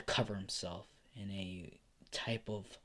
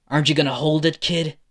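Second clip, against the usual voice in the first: raised, higher taunting challenge; loud taunt; 4 semitones higher, 7 dB louder, faster than usual. Acted. Endeavor taunting Izuku over his promise, loud and mocking. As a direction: goad the kid loudly.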